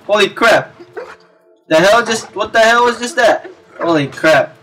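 ordinary talk, nothing marked

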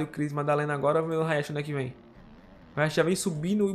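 usual speaking voice

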